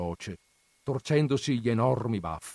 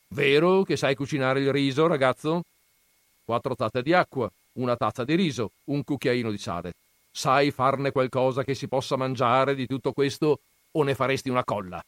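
lightly voiced scornful old grandfather, raised; gruff grandfather; 5 semitones higher, 5 dB louder, faster than usual. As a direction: scoff at the books' dreamers. Grandfather quizzes boy. (nonno burbero accennato, tono sprezzante)

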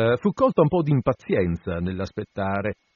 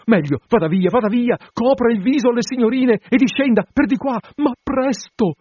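high, loud, hurried alarm; panic-stricken baroness hustling the guests out the back way; panicked urging; 11 semitones higher, 5 dB louder than usual. A narrator's reading. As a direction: shoo them out in a frantic rush. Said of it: "La Colle Maggio saltò su d'improvviso".

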